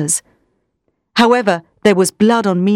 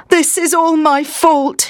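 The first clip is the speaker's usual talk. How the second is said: moaning; 7 semitones higher, slower than usual. Acted. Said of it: "he moaned".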